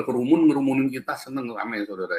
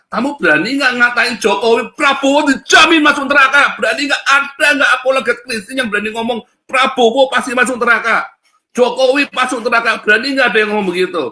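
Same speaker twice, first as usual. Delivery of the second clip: loud, high preaching shout; fiery challenge; 10 semitones higher, 8 dB louder than usual. Real, not acted. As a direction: dare them, voice raised. Preacher challenging listeners loudly.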